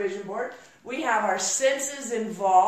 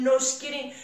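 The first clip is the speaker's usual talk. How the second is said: normal talking voice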